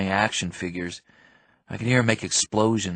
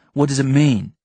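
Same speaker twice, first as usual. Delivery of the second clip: higher, louder demand; 4 semitones higher, 8 dB louder than usual. Acted. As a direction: push him for an answer. Demanding an answer in argument.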